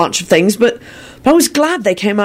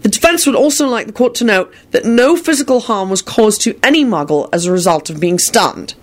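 higher-pitched, determined defense speech; 7 semitones higher than usual. A narrator's reading. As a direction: argue the case with resolve. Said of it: "Harry said determinedly".

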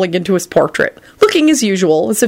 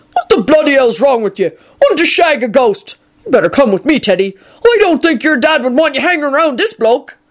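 higher, scolding rustic giant voice; revolted Hagrid; 8 semitones higher than usual. Acted. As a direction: scold the boy in disgust.

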